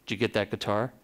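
a hint of [name], low and soft; low soft [name] impression; [name]'s voice. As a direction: lightly mimic [name]'s low murmur. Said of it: "that's how [name] talks".

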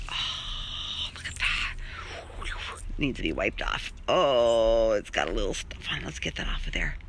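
soft unvoiced whisper; hushed whisper over fruit. Real, not acted. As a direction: murmur it under your breath.

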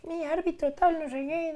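high-pitched mock whine to the referee; complaining forward. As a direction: imitate a moaning striker, high and small. (voz aguda de delantero quejica, en burla)

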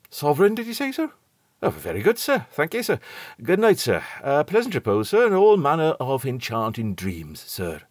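pleased steward thanking for the sovereign; fawning, eager goodnight; delighted steward. A narrator's reading. Steward Robert voiced in story.

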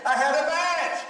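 high, indignant cry of disbelief; exasperated outcry. Retold or imitated. Pastor recalling his frustration at the detention center, high exasperated cry. Pastor retells losing focus.

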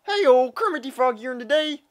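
very high, loud puppet frog voice; Kermit the Frog. Acted. Frog greeting the audience, high loud puppet impression. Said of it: "I have seventeen impressions for you".

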